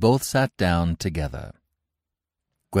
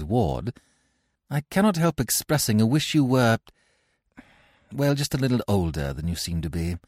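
higher, gracious society lady; a hint of a gushing Victorian lady; lightly voiced haughty lady; 6 semitones higher, 5 dB louder than usual. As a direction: play a grand lady being charming. Gwendolen voiced in read play.

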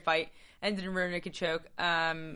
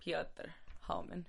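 slow mock Russian accent on Piotr Hallman; a light put-on Russian accent; 13 dB quieter, slower than usual. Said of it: "You like that little Russian accent?".

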